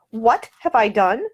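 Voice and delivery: slow, incredulous repeat of the question; stunned echo. Acted. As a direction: echo it back in disbelief. She is accused, goes rigid.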